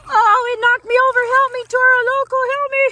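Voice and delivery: high, quick mock distress; pretend cry for help. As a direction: squeal for help in play. Toy knocked over, calls for help.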